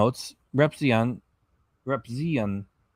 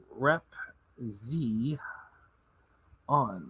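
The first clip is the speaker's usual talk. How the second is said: ordinary talk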